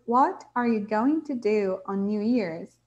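slow, clear classroom reading; slow model reading. Shown as a demonstration. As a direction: read it out slowly for the class. (leitura lenta e clara de professora)

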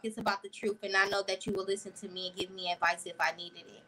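ordinary talk, nothing marked

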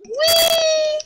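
high drawn-out whee; gleeful squeal. Real, not acted. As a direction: squeal as if flung through the air. Flung about in the game.

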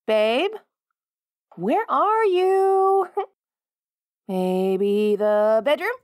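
high, slow searching call. Acted. Looking room to room for partner, drawn-out call. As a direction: stretch the words out as you search.

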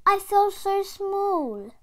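a tiny creature feeling tiny; small snail voice. Acted. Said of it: "she said to the whale".

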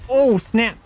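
loud high startled cry. Real, not acted. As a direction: react to a sudden hit. Surprised in combat.

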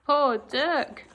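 plain reading voice

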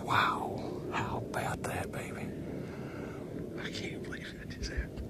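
very soft, awed whisper; barely voiced, stunned. Real, not acted. Whispering after the kill.

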